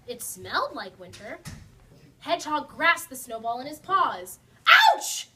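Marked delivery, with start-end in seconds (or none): 4.7-5.3 sharp high startled yelp; cry of pain. Acted. Hedgehog touching the icy snowball, yelping. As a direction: yelp at the sudden cold. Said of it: "he cried".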